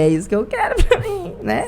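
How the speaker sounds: said through a laugh; light laughing quote, eager; laughing as she recalls telling her father her choice. Retold or imitated.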